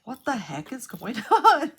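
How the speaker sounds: recounting her panic at the alarm, half laughing; alarmed exclamation. Retold or imitated. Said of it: "I'm like".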